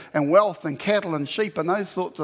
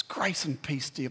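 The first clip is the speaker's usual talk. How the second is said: calm greeting; 3 semitones lower, 7 dB quieter than usual. Retold or imitated. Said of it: "He says".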